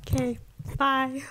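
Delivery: usual speaking voice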